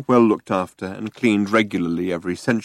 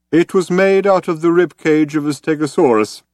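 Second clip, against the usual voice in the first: put-on higher, louder character voice of an old man; old man explaining; 6 semitones higher, 4 dB louder than usual. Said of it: "explained the old man".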